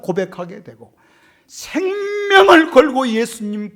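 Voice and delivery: high, loud impassioned sermon delivery; fiery preaching. Real, not acted. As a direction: preach it to the back row. Preaching at full force. (높고 큰 목소리로 열정적인 설교)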